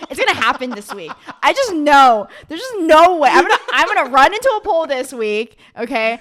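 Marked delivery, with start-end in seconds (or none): 1.4-5.6 excited. Real, not acted. Joking dread of jinx.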